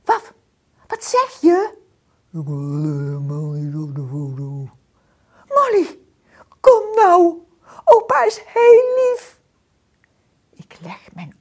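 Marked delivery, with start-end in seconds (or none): 0.0-1.8 dog voice. A narrator's reading. Dog asks. 2.3-4.7 low, fast voice from underground; mole voice from deep. A narrator's reading. Mole refusing from the depths of the hole, low. 5.5-9.4 high, loud pleading call; dog voice coaxing. A narrator's reading. Dog coaxing Molly out of the hole, high and loud.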